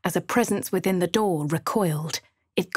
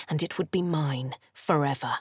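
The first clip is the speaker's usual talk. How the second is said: ordinary delivery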